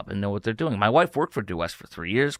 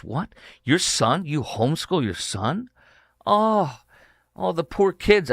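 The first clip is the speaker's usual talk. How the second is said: high, condescending fake pity; mock pity; 5 semitones higher, slower than usual. Radio host imitating a sneering columnist's mock pity. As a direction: sneer with pretend sympathy for the kids. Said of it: "He goes".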